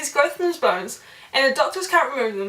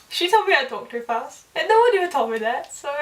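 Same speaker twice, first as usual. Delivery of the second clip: ordinary talk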